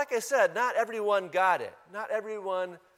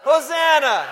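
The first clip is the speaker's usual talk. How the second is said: long loud higher Hosanna; shouted Hosanna; 7 semitones higher, 12 dB louder than usual. Shown as a demonstration. Pastor leading the congregation in a shout, loud. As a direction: lead the shout with full voice. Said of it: "Let's try it again".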